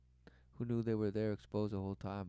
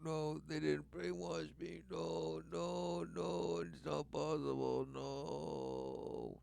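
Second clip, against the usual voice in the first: high, drawn-out sarcastic protest; singsong mock disbelief; mock denial; 5 semitones higher, 4 dB quieter, much slower than usual. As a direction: protest in a put-on high voice, slowly. Sarcastically denies the brainwashing claim.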